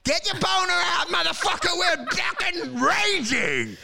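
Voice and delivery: high-pitched shouted salesman hype; raging hype. Acted. Broker telling a newly rich client, hyped-up shouting. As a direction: whip the client into a frenzy, drawing out the end.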